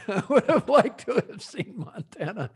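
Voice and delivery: laughing through the quoted line. Real, not acted. Host repeats the Hunt for Red October line, laughing. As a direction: chuckle while repeating the movie line.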